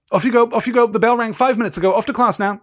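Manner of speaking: high, loud, hurried shooing; bossy prefect. Acted. Percy shooing younger students to class, brisk and loud.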